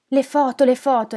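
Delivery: loud, high, obsessive repetition; insistent repeated plea. Acted. Old husband fixated on his photos, insistent pleading. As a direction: call for them over and over. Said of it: "ripeteva ormai in continuazione".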